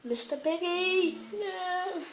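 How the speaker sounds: hammed-up sorrow for a friend; mock lament.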